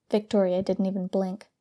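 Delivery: ordinary delivery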